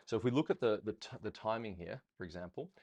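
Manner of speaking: ordinary talk, nothing marked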